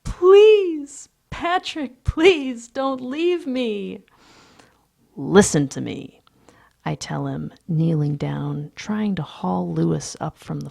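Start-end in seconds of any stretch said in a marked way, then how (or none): 0.0-4.0 high, drawn-out desperate plea; crying out pleading. A narrator's reading. Lewis begs Patrick not to leave, crying out. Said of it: "cries out". 5.1-6.2 loud, firm 'listen'; firm command. A narrator's reading. Narrator tells clinging Lewis to listen, firmly. Exasperated man to clinging colleague.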